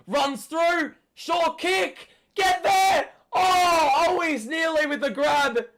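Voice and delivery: high, loud urgent shouts; frantic yelling. Real, not acted. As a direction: scream at the players to get there. Fan yelling during a scramble.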